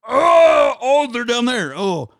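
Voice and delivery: loud, high cry of discovery; hammed-up pursuer spotting them below; mocked pirates arriving. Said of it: "running up to the manhole cover going".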